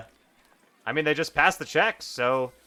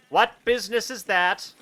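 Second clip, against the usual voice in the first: higher, louder pompous guard; kobold guard; 8 semitones higher, 4 dB louder than usual. Acted. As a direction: bark the challenge.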